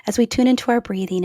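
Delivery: usual speaking voice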